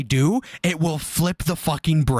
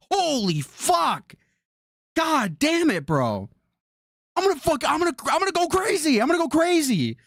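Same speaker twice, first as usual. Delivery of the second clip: much higher, frustrated near-wail; losing it over the hair, pitched way up; real frustration, voice climbing high; exasperated meltdown; 10 semitones higher than usual. Real, not acted. Hair keeps blowing into face.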